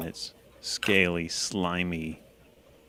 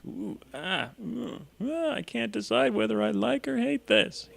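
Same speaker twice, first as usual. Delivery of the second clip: high, muffled, torn between delight and disgust. Acted. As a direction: squeak it out, face pressed in. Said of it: "he says in a muffled voice".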